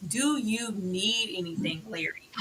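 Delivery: soft, slow, gently attentive; supportive. Acted. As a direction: offer help quietly, dropping away on the name. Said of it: "be supportive".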